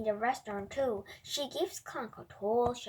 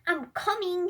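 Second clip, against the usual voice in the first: shout; 7 semitones higher, 5 dB louder than usual. A narrator's reading. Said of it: "he shouts".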